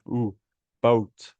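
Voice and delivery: "Ooh" with rounded lips, carried into "boat"; rounded lip sound. Shown as a demonstration. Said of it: "lips must make round sound".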